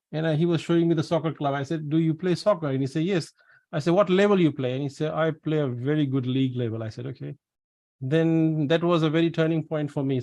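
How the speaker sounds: ordinary talk, nothing marked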